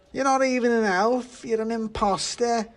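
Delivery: high, loud pudding shriek; shrieking in high-pitched indignation; outraged shouting. A narrator's reading. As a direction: scream the accusation. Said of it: "shouted the pudding".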